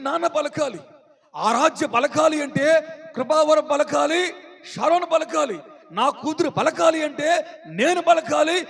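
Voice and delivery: urgent sermon delivery; insistent preaching. Real, not acted. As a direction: drive each repeated point home.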